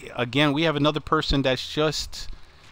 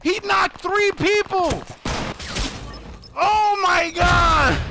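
stunned, pitched-up yells, drawn out slowly; amazed high shouting; 3 dB louder, slower than usual. Real, not acted. Streamer watching his teammate's wild play, amazed high shouting. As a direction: shout in disbelief at an unbelievable play. Teammate wipes out enemy squad.

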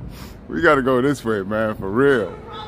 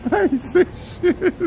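laughing; 9 semitones higher, 5 dB quieter, slower than usual. Real, not acted. Repeats denial laughing.

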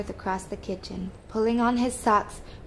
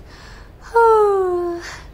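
high, loud acted yawn; 11 semitones higher, 9 dB louder than usual. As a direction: yawn hugely, sliding down from a high pitch. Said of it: "Emma and Sam yawned".

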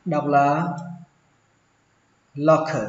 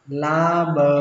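syllable by syllable. Shown as a demonstration. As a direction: stretch out each syllable of "laboratory". Syllable-by-syllable first model for learners.

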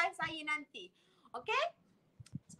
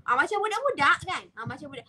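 ordinary talk

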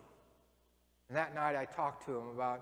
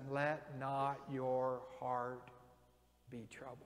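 gentle Jesus quote; 3 semitones lower, 7 dB quieter, slower than usual. Retold or imitated. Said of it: "And Jesus says".

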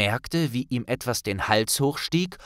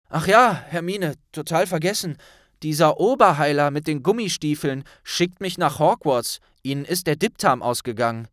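put-on hurried boy's voice, louder; blurting it out on the way; Ron voice; 6 semitones higher, 6 dB louder than usual. Ron turns at the door and tells Hermine.

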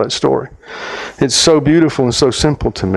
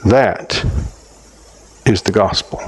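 hushed divine voice; 5 semitones lower than usual. Retold or imitated. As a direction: tell him gently, almost a whisper. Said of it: "here is what I heard".

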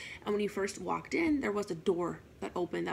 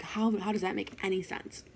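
usual speaking voice

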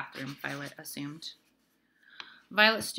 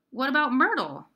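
higher, louder pressing demand; a child's sharp, demanding question; 8 semitones higher, 8 dB louder than usual. A narrator's reading. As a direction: insist on an answer. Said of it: "Violet demanded".

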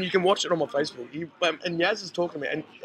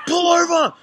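high, loud retold cry to stop the car; panicked order; 11 semitones higher, 8 dB louder than usual. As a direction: shout it from the passenger seat. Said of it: "I was like".

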